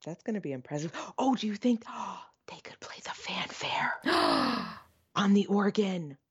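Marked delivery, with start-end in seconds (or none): 1.2-6.2 thrilled, with audible gasps and breathy whispered bursts; gasping excitement. Real, not acted. Dreaming up a fanfare on the cathedral organ, gasping excitement. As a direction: gasp the question out in awe, higher and breathless.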